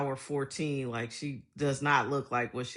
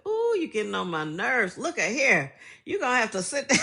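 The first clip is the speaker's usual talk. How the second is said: louder, higher mock scolding, laughing at the end; mom's scolding; 7 semitones higher, 7 dB louder than usual. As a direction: imitate mom's fed-up scolding, cracking up. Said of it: "That was her number one thing".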